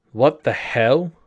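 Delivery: slurred. A narrator's reading. Huffing for breath as she confronts the group, slurred. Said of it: "She slurred the words".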